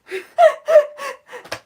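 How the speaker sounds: bubbling string of little laughs; giggle. Real, not acted. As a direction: giggle at your own joke. Laughs at own joke.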